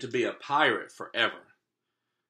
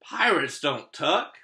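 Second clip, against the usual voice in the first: gruff pirate captain; slower than usual. Acted. Captain refusing the boy's bedtime request, gruff.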